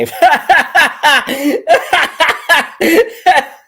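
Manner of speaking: big loud burst of laughter; real belly laugh. Real, not acted. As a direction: let the laugh explode. Laughing at cohost's joke.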